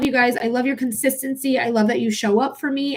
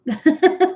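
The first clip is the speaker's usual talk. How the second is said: short bright burst of laughter; real laugh; 7 semitones higher, 5 dB louder than usual. Real, not acted. Streamer laughing at a website name to her viewers, real laugh. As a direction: laugh out loud at the silly name.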